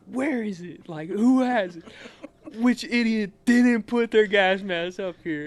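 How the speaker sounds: mildly hammed-up angry sergeant; getting mad. Drill sergeant demanding the missing gas mask, getting mad. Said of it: "he started getting mad".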